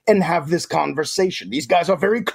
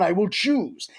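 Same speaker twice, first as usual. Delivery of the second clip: normal talking voice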